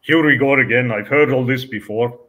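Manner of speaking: lightly done bad Russian accent on a traffic cop. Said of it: "I've got to do my bad Russian accent".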